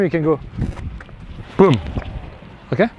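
ordinary talk

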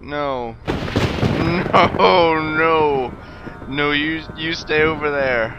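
loud frightened shouting; panicked yelling. Real, not acted. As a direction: yell in real panic.

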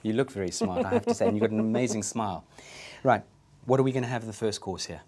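usual speaking voice

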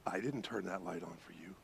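a hint of the calm guide; hushed guide. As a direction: say it low and quiet.

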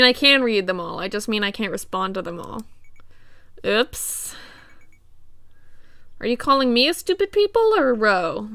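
ordinary talk, nothing marked

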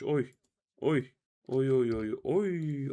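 loud delighted string of oys; excited oy oy. Real, not acted. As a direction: cheer at the drop. Excited over loot drop.

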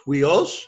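huios said "hwee-os" with an aspirated start; teacher's careful huios, h sounded before the glide; rough breathing. Shown as a demonstration. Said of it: "rough breathing is on the iota".

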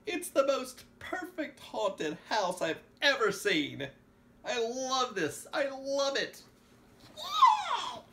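high, loud, drawn-out raving delight; Christopher Joel. A narrator's reading. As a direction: cry it out ecstatically, building to a whoop. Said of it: "he cried".

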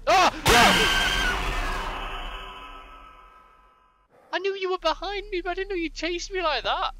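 piercing terrified scream; scared horror game streamer. Real, not acted. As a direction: shriek as the monster grabs you. Enemy catches player from behind.

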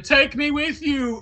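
slow, high mock plea; pleading Bastion. Bastion running after Einstein, hammed-up pleading. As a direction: drag out a hammed-up plea to come along. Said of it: "he was like".

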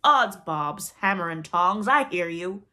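loud, blustering pirate captain; Hook's voice. A narrator's reading. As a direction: boom it out like a mimicked pirate. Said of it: "answered in Hook's voice".

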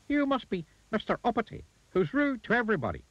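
high-pitched little goblin. A narrator's reading. Goblin to rude Mister Uppity, high goblin voice. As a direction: speak well above your own pitch, as a goblin.

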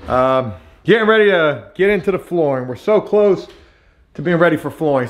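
ordinary talk, nothing marked

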